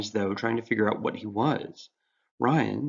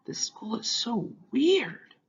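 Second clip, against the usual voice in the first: muttering to himself; 13 semitones higher, much slower than usual. Acted. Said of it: "muttering to himself".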